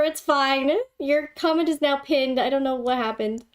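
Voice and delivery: ordinary talk, nothing marked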